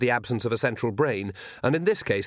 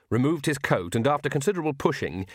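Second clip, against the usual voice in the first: ordinary delivery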